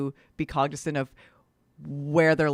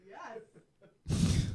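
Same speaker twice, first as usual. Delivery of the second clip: ordinary talk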